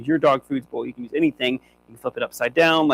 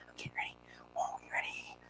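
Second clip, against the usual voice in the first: soft whisper to build suspense; whispered teasing; 21 dB quieter, faster than usual. Real, not acted. Trainer teasing the dog before release, whispering.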